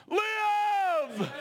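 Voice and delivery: a sudden high, loud cry of Live; shouted command. Acted. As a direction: thunder it out as God's command. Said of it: "Jesus Christ said".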